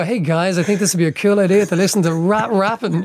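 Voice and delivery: lightly acted keen small child; eager preschooler. Retelling asking playschool classmates to hear a record, eager preschooler. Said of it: "It was just like".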